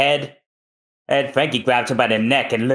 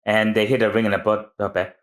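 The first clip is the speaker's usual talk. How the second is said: ordinary delivery, nothing marked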